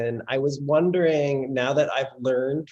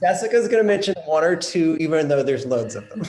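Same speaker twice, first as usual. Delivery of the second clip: talking through bursts of laughter, higher and louder; laughing; 9 semitones higher, 6 dB louder, faster than usual. Real, not acted. Host reassuring the guest after her protest, laughing. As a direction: protest while laughing, voice jumping up.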